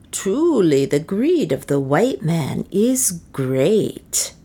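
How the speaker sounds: slow, sneering woman's voice; satirical. A narrator's reading. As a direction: drawl the taunt slowly. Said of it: "said a satirical voice".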